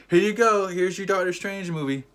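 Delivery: high, loud mock-cheerful handover; mock server. Imagined server handing over a chaotic movie, high and loud. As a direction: present it loudly in a high put-on voice.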